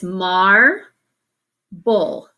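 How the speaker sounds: mar and bull said apart with a pause; split into syllables. Shown as a demonstration. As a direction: say "mar" then "bull" as separate beats. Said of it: "So it goes like this".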